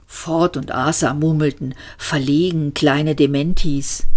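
usual speaking voice

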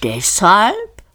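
langsam, gedehnte Rinderstimme; höfliches Rind. A narrator's reading. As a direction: ein freundliches Schlachttier, das sich anbietet. Das Tier spricht. (das Hauptgericht stellt sich den Gästen gemächlich vor)

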